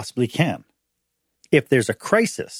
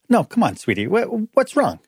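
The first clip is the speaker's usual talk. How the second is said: coaxing plea; 8 semitones higher than usual. Retold or imitated. Husband pleading with wife.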